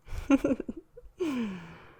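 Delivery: hushed chuckle trailing into a sigh; amused little laugh, falling away. Acted. Laugh after marshmallow idea.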